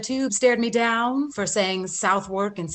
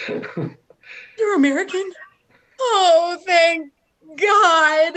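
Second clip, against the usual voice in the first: tearful and higher, voice breaking with relief; relieved crying; 5 semitones higher, 5 dB louder, slower than usual. Acted. Olivia to the man in the dress, relieved tearful crying. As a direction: sob out your relief at home found.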